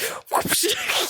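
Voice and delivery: high unvoiced giggle; wheezy silent laugh; breathless laugh. Real, not acted. Streamer giggling at the sugar plum scene, breathless.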